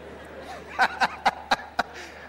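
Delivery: voiceless mock laughing in short bursts; demonstrated laugh. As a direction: imitate someone laughing at a comedian. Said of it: "they don't relax like this".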